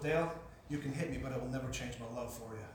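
low, soft, unshaken love; tender reassurance. Retold or imitated. Pastor facing Dale's balled fist, tender reassurance. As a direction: answer the threat gently, low and quiet.

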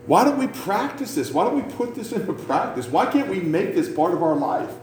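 ordinary delivery

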